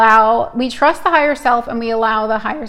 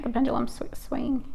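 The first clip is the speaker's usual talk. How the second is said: whispered and much softer; 10 dB quieter than usual. Real, not acted. Reader watching her pendulum, whispering to the stream. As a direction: breathe it out so the pendulum isn't disturbed.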